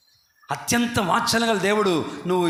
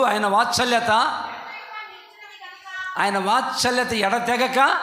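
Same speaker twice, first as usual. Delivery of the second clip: slow, raised, with a laugh of joy; fervent scripture reading; 6 semitones higher, slower than usual. Acted. Preacher reading Lamentations to his church, slow and joyful. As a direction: savour each word of the verse.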